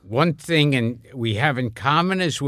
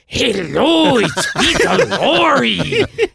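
high, loud, long-drawn caricature voice; Peter Lorre imitation; 9 semitones higher, 4 dB louder, much slower than usual. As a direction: correct him with a hammed-up, stretched-out impersonation. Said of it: "do a good Peter Lorre imitation".

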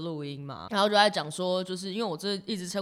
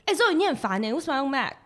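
higher, louder annoyed coworker; 6 semitones higher, 4 dB louder than usual. Retold or imitated. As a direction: nag like a fed-up coworker. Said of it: "同事会说".

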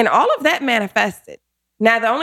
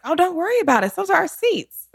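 breezy bluff; 9 semitones higher than usual. Retold or imitated. Retold breezy bluff about seats.

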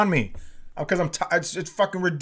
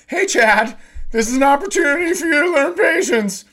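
high, loud mocking singsong, much higher; mocking internet voice; 12 semitones higher, 6 dB louder than usual. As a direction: parrot the advice back in a squeaky mocking voice. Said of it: "and it's like".

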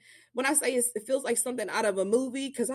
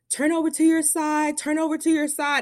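higher, pressing commands to turn over; urgent nurse orders; 7 semitones higher than usual. Retold or imitated. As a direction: hurry the patient onto her side. Staff rushing in, urgent orders.

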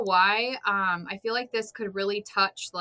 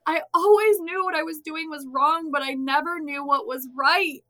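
earnest confession; 8 semitones higher, 6 dB louder than usual. Retold or imitated. Quoting her own confession.

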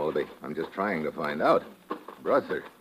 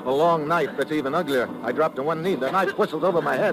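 tense narration, rising with the fight; hardboiled radio narrator in a gunfight; action narration; 8 semitones higher than usual. A narrator's reading. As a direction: tell the fight blow by blow.

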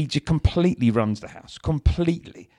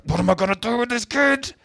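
mock growl under breath; 12 semitones higher than usual. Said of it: "growling under my breath".

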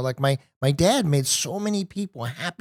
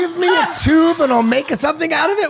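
high and laughing, parroting the quote; teasing laughing repeat; laughing mock echo; 13 semitones higher, 5 dB louder than usual. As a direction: repeat the line in disbelief, cracking up. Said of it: "Oh, God".

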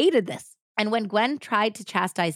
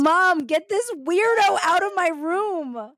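put-on high, loud teenage son yelling to Mom; mock yell for help; 11 semitones higher, 5 dB louder, slower than usual. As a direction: drag out a high, exasperated cry for rescue. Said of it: "son yelling for help".

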